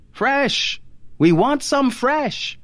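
high, slow, insistent call. A narrator's reading. Husband demanding new coffee from his wife, calling insistently. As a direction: call it out high, stretching the words.

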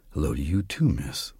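low, soft voice for Death; a little below narration. Shown as a demonstration. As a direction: drop under your narrator voice. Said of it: "a little below my narrative voice".

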